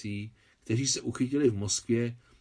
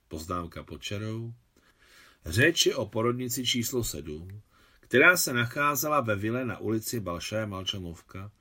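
ordinary delivery, nothing marked

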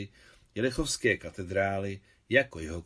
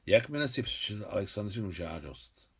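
usual speaking voice

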